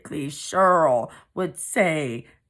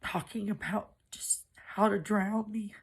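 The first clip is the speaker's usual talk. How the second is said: softer, barely voiced; whispered confession; 5 semitones higher, 6 dB quieter than usual. Real, not acted. Narrator confesses planning her drowning in a whisper. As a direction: let it fall to a whisper.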